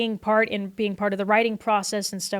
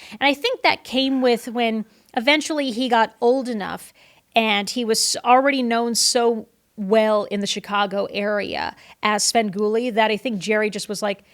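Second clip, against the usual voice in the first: ordinary delivery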